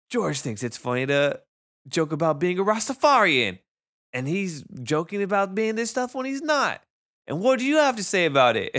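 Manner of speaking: mock teacher voice. Teacher quoted on phone.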